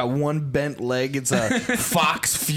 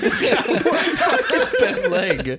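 high, slow, laughing through words; can't stop laughing mid-question; 9 semitones higher, 4 dB louder, slower than usual. Real, not acted. Cracking up at the product.

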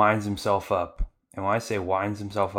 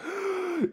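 gasp. Retold or imitated. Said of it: "He's like".

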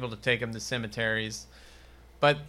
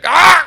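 sudden high, loud shriek; a short yelp; mock scream; 20 dB louder than usual. Kid cries out at the attack, sudden loud shriek. Joke about killing the kid.

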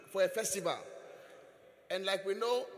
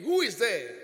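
loud who-is-there through the door; loud voice from behind the door; loud call from inside; 9 dB louder than usual. Retold or imitated. Voice from within, loud.

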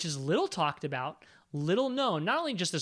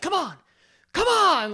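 loud, high mock yell of come on; mock shout of come on; 12 semitones higher, 9 dB louder than usual. Podcaster mimicking Doc Holliday taunting Ringo, loud mock yell. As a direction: bellow come on like Doc egging him. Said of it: "Holliday's like".

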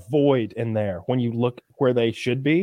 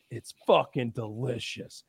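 softer, slow awed praise; quoting judges; 3 semitones lower, 7 dB quieter than usual. Retold or imitated. Judges tasting the pie, softer and slower. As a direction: savour every word. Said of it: "each one is like".

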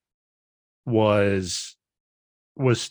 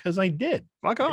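higher, playful brush-off; defiant; 8 semitones higher than usual. Retold or imitated. Greg defending his taste to his friend, playful defiant brush-off. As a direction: toss it back cheekily.

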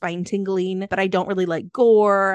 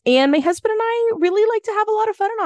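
raised, quick and bright delivery; high excited voice; 13 semitones higher, 4 dB louder, faster than usual. Real, not acted. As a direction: pitch jumps up with eager speed.

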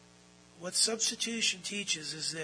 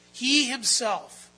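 "He himself" pitched up and louder; emphatic; 6 semitones higher, 10 dB louder, much slower than usual. Shown as a demonstration. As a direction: punch "He himself" slowly. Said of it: "it's emphatic".

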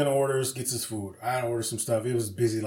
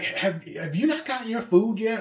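higher, drawn-out retold complaint; imitated impatient customer; 9 semitones higher than usual. Retelling a woman waiting at McDonald's, lightly imitated impatience. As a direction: lightly voice an indignant customer, pitched up.

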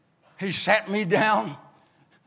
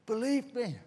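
ordinary talk, nothing marked

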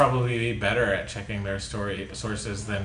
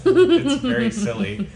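laughing; 10 semitones higher, 6 dB louder than usual. Real, not acted. Laughing at silly memory.

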